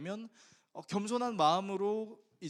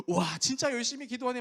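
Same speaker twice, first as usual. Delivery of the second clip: admiring elders; 7 semitones higher, 6 dB louder than usual. Retold or imitated. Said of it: "막 칭찬을 한 거예요".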